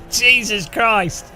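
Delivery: stretched exasperated groan. Real, not acted. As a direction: groan at how bad it is. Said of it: "It's the worst song ever".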